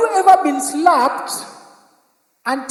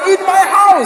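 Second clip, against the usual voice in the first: mock angry dad; 4 semitones higher, 6 dB louder than usual. Dad repeats, loud.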